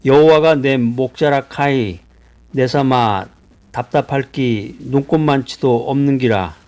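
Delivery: Psalm in a light Gyeongsang dialect, slow start; a preacher lightly playing a Gyeongsang grandpa. Shown as a demonstration. 경상도 사투리로 교인들에게 시편을 읽어 주는 설교자, 가볍게. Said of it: "시편 이십삼 편을 경상도 방언 버전으로".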